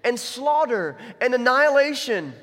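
slow, rising, loud declaration; forceful, drawn-out plea; impassioned appeal. Acted. Acts Esther's impassioned accusation to king.

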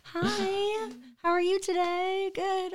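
hammed-up sweet polite voice; sugary sweet greeting. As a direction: greet a stranger overly sweetly. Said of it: "And now I'm like".

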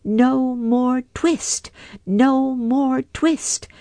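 high, slow mouse chant; twittering mouse shout. A narrator's reading. Mice shutting out Simpkin, high slow chant. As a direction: squeak it out like tiny mice. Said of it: "shout at once in little twittering voices".

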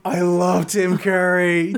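normal talking voice

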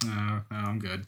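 muttered softly under breath; quiet aside. Retold or imitated. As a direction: say it soft and dismissive. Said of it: "I'm like".

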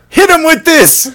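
high, loud excited shout; gleeful mock order. Game ordering an attack on dying player, gleeful shout. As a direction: yell it like a scheming saboteur. Voices the game's gleeful trigger.

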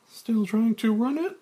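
high, fast woman's voice; Claire. Acted. Claire noticing Frank's change, in a high voice.

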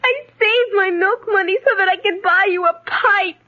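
tearful explanation with sobbing breaks; crying. Acted. Girl handing father his gift, crying. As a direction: get it out between sobs. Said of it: "please stop crying".

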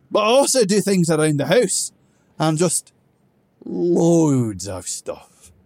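blustering dwarf who knows he has no case; gruff dwarf, drunk and defensive. Acted. Grog defending his life to Theethum, loud drunken bluster.

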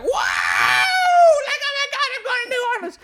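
very high, loud squeal, rushing at the end; hammed-up game show shriek; mock contestant excitement. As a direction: imitate a prize winner screaming with joy. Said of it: "everybody's like".

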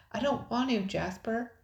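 high, upset reluctance; beginning to waver. A narrator's reading. Frightened sister resists going out, starting to waver. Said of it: "Nicole's voice was beginning to waver".

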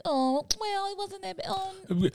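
high-pitched mock excuse-making; mock forgiving. Voicing the public excusing a star, high mock-forgiving. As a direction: play a forgiving fan in a high voice.

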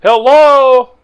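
calling out. Real, not acted. Calling down into the well.

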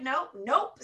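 "nope, nope" each ending on an upward lilt; rising nope. Shown as a demonstration. Supervisor's drilled no, each "nope, nope" ending on a rise. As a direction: bounce each "nope" up at the end. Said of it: "so that the voice inflection went up".

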